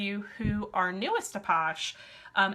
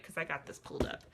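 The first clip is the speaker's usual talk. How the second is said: muttered aside; 4 semitones lower, 9 dB quieter, faster than usual. Real, not acted. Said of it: "muttering while pulling up phone".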